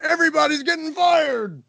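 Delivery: high, shrieking mock panic with a sob. Techs panicking that everyone gets fired. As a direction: squeal it like the sky is falling. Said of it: "they're like".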